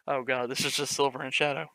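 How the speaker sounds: ordinary talk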